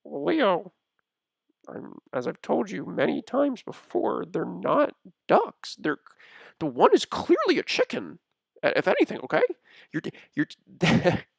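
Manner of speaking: high character voice, firmly correcting; high-pitched, insistent and faltering into a laugh; exasperated Albatross character. Acted. Albatross insisting to Leo they are not ducks, high exasperated voice.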